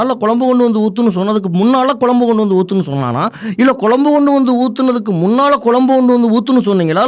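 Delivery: fast, piling question on question; rapid-fire questioning. Acted. Visu grilling the crying man about the curry, fast. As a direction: rattle off the tongue-twister without a breath. Rapid tongue-twister interrogation.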